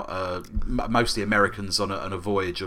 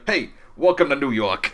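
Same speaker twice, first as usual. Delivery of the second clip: New York cab driver; 3 semitones higher than usual. Retold or imitated. Cab driver's wry quip.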